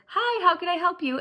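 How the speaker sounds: high, bright customer-service voice lightly imitated; mimicking the intercom nurse. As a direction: playfully copy the nurse's chirpy greeting. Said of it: "She says".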